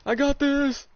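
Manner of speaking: high, loud triumphant yell; excited shout. Real, not acted. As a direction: shout it like a win. Excited call in game.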